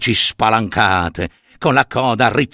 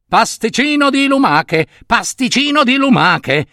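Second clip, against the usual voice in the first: cantilena molto acuta da bimbo viziato; reuccio capriccioso; 10 semitones higher than usual. Acted. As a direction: pretendilo con voce alta e lamentosa. Il reuccio ripete la sua voglia. (capriccio acuto e insistente)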